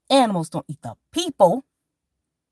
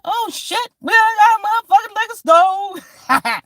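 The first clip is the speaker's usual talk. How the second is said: high, loud, slow shocked yell; excited shout; 14 semitones higher, 8 dB louder, slower than usual. Retold or imitated. Comic reliving the new liquor store, shouting in disbelief. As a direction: yell it like you can't believe your eyes. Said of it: "I'm like".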